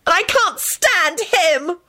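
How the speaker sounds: high, drawn-out tearful screech. A narrator's reading. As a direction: screech it slowly at the top of your range. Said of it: "she screeched at Henry".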